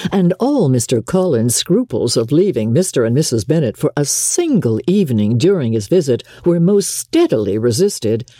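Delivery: ordinary delivery, nothing marked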